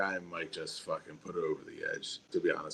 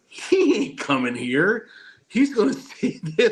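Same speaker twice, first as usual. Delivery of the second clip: retold scoff, climbing in pitch; knowing disbelief; 8 semitones higher, 4 dB louder than usual. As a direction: call it out like it's obvious he's staying. Predicting friend won't leave Amsterdam.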